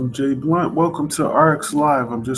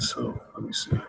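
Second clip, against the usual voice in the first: under-the-breath murmur to yourself; soft aside to self; muttered to self; 12 semitones lower, 9 dB quieter than usual. Real, not acted. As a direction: mutter it while fiddling with settings. Checking the stream alone, muttering.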